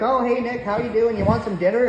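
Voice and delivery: high, sweet hostess mom; mom's voice. Retold or imitated. Mom offers dinner to her son's friend, high and sweet.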